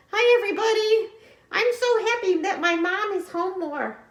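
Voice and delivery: high, fast, loud little puppet voice; puppet buddy Mac. Pretend. Puppet Mac greets the kids, high and loud. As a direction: squeak it out like a cheerful puppet friend. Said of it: "Mac, say hi to the friends".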